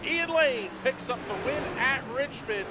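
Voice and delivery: high, loud triumphant call; excited winner call. Real, not acted. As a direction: announce the winner at full pitch. Winner called at the flag.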